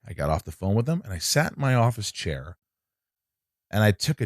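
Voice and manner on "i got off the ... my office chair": usual speaking voice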